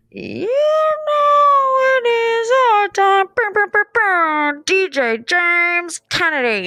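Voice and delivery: very high, slow, stretched hype-man call; DJ hype intro. Retold or imitated. Imitating the DJ opening his set, slow hype call. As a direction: boom it out like a DJ on the mic. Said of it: "he begins obviously with".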